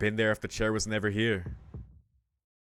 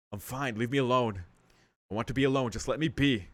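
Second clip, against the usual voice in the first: ordinary talk, nothing marked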